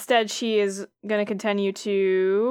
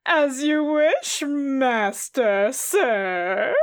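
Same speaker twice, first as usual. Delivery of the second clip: mindless servant voice; 3 semitones higher, much slower than usual. Acted. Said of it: "the puppet creature's like".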